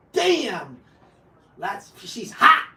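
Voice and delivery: slow, stunned admiring drawl; mock the husband lost for words; awestruck husband. Husband eyeing his wife, slow and stunned.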